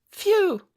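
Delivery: high-pitched exhale of relief; high relieved phew. Acted. Aura wipes her forehead.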